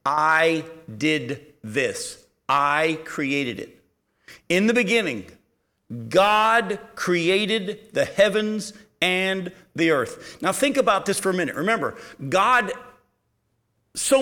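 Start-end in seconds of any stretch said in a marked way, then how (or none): 0.1-3.8 very slow, weighted word by word; slow, solemn proclamation; God's emphatic declaration. Acted. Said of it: "God goes out of his way to say to us". 4.5-10.4 slow, emphatic reading with pauses between phrases; deliberate, weighted pulpit reading; slow scripture reading. Shown as a demonstration. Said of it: "I'm gonna read this slowly".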